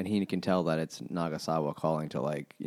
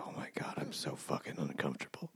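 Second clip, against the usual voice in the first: whisper; 6 semitones lower, 15 dB quieter than usual. Retold or imitated. Awkward dinner, Toru's inner voice.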